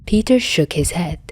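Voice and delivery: plain reading voice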